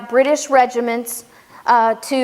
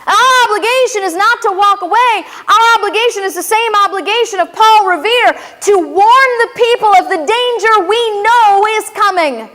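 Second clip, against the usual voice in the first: raised, louder preaching call; rally the congregation to duty; rousing sermon; 7 semitones higher, 3 dB louder than usual. Acted. Preacher urging the audience to warn others, raised and rousing.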